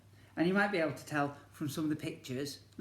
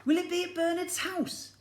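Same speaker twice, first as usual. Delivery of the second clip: put-on much higher, curious child voice; little boy Alfie; 6 dB louder than usual. Alfie asks Mum about the party, high child voice. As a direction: ask it like a wide-eyed small boy.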